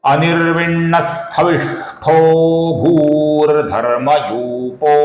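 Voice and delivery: slow measured verse chant; slow Sanskrit shloka chant; shloka recitation. Shown as a demonstration. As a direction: recite slowly, syllable by syllable. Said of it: "फिर से एक बार देखिए".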